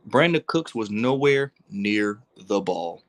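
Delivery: slow, deliberate word-by-word delivery; slow, emphatic. Real, not acted. Host ranting about Dak's throw, slow and emphatic. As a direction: spell it out slowly for them.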